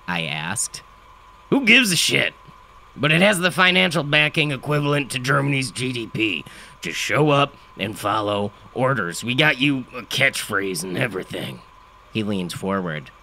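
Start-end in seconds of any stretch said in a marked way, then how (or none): 1.5-11.6 gravelly father voice. A narrator's reading. Father voice in story.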